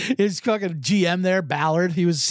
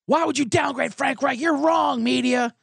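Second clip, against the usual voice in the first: higher, pushy mocking owner voice; mock Irsay rant; 7 semitones higher than usual. Owner lecturing the media in a higher mock rant. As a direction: ham up the angry owner lecturing the press. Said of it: "He goes".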